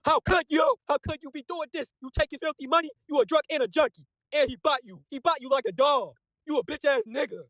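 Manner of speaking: high-pitched, mock-outraged shouting; outraged confrontation. Comic recapper voicing a character berating a traitor, high outraged yelling. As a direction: hurl accusations in a high, strained cry.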